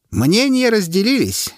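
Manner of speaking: high, slow pronouncement; herald's announcement. A narrator's reading. Herald sums up the vote to the crowd, slow and high. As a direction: sum it up for the crowd.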